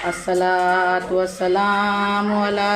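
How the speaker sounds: slow, low, drawn-out Arabic recitation; Quran recitation. Acted. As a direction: intone the verses slowly and low. (धीमा, गहरा क़ुरआनी तिलावत का स्वर)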